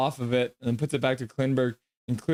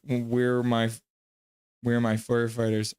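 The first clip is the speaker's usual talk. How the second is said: usual speaking voice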